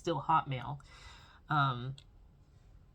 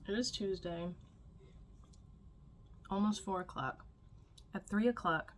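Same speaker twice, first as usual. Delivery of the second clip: mouth full; 3 semitones higher than usual. Real, not acted. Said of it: "sorry, I got candy in my mouth".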